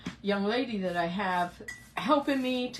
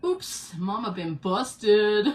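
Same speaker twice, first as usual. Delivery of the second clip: slow, drawn-out mock confession, louder on the end; playfully caught out; 7 dB louder, much slower than usual. Real, not acted. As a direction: own up with a comic drawl. Caught running the air conditioner in cold weather.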